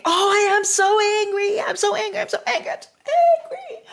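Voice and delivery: high-pitched mock rage that laughs and fades to nothing; hammed-up mock anger. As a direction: play at being furious, then let it deflate.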